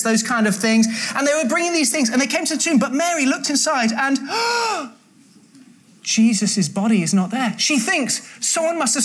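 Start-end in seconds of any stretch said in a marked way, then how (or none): 4.2-4.9 sharp acted intake of breath; shocked gasp. Storyteller gasps as Mary looks inside tomb, shocked gasp.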